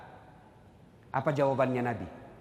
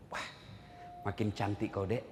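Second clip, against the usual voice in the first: rayuan suami; 9 semitones lower, 11 dB quieter than usual. Acted. Suami memuji istri, dialog lucu.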